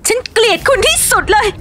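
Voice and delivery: high, hurt outburst; thai lakorn drama. Acted. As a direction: cry out in heartbreak. Heartbroken wife lashes out. (ตะโกนด้วยความเจ็บปวด)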